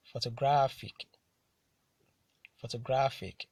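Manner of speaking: "photographic" with GRA hit harder; careful model reading of "photographic", stressed on GRA; penultimate stress. Shown as a demonstration. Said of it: "stressed on the penultimate syllable".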